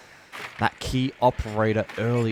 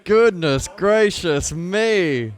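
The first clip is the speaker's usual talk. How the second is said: awed; 9 semitones higher, 8 dB louder, much slower than usual. Real, not acted. Reaction after the clutch.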